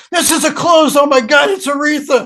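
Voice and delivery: high, loud retold gasp of astonishment; startled recognition. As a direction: act out annoyance turning to starstruck shock. Bandmates suddenly see [name] at door.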